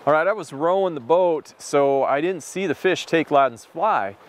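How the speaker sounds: usual speaking voice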